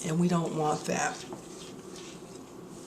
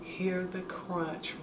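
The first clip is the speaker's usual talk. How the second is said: hushed and slow; 9 dB quieter, much slower than usual. Real, not acted. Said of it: "I gotta let you hear the crunch".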